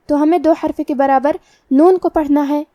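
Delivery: normal talking voice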